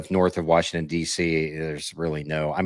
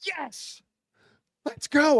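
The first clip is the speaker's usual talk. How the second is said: mock excitement; much slower than usual. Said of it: "I'm like".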